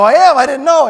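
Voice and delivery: loud, high disbelieving reply with a laugh; startled laughing outburst; surprised. Retold or imitated. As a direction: caught totally off guard, laughing. Surprised by news at party.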